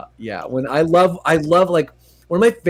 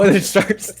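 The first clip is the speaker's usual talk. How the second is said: ordinary talk, nothing marked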